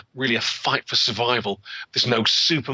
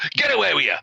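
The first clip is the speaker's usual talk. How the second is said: a touch of a raised shout, higher; mild mock shout; 6 semitones higher, faster than usual. Peddler shooing off the girl, mild mock shout. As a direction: bark him off, lightly. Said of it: "shouted John Law".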